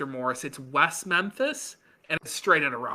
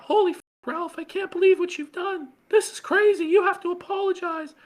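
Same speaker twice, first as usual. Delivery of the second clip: much higher, louder panicked voice; imitating Pantsu; 13 semitones higher, 4 dB louder than usual. Host imitating Pantsu pleading with Ralph, high panicked voice. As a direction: shriek in mock alarm. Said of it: "Pantsu was like".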